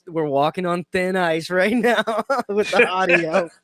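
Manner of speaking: breaking into laughter while talking; laughing. Real, not acted. Host joking with co-hosts about the risky audio, laughing. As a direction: let the giggles take over mid-sentence.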